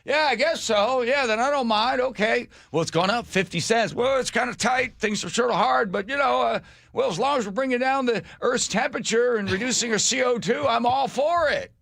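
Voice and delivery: a gullible motorist talking himself into it; mock naive driver. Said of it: "go to a gas station".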